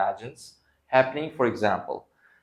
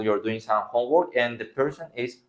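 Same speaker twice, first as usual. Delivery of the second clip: ordinary talk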